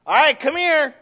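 loud high parental call; calling a child. Retold or imitated. Preacher imitates calling his runaway kid, loud and high. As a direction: call the kid over from across the house.

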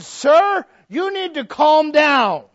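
loud, high, drawn-out order; hammed-up forceful demand; retold stern command. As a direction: shout it slowly at an irate man. Retells confronting a yelling man.

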